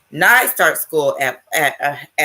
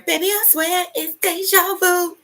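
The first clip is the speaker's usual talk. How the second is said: much higher, far-off voice; a higher voice quoting the friend's sister; higher voice of the sister on the phone; voice she hears. Retold or imitated. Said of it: "and then I hear".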